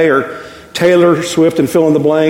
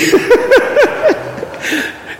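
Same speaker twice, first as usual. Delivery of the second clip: a real, high, airy burst of laughter; high breathless laugh. Real, not acted. Preacher laughing at his wife's favorite song title, high and airy. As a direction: crack up at the joke.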